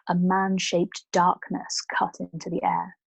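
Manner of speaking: usual speaking voice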